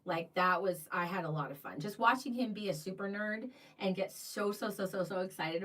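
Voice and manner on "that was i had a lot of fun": ordinary talk, nothing marked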